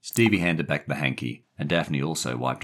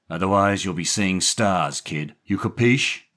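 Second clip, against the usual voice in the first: gruff warning, rising on the final question; mild threat; 5 semitones higher, 5 dB louder than usual. A narrator's reading. Jerry warning the boy before leaving, mild threat. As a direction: menace him a little, ending sharply higher.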